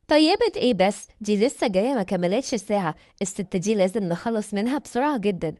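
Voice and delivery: plain reading voice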